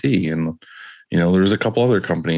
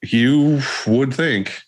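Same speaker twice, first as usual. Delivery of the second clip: drawn out; 7 semitones higher, much slower than usual. Acted. Drawn-out knowing reply.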